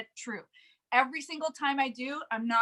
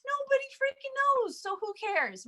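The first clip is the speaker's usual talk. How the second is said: very high, held-up pitch; high-pitched emphasis; 12 semitones higher, 3 dB quieter than usual. Acted. Coach warning leaders who never post, high-pitched. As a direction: pitch it way up to drive home the point. Mocking unseen leaders' obscurity.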